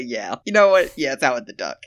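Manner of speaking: usual speaking voice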